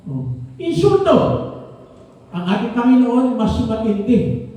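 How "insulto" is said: emphatic. Real, not acted. Preacher punches key word.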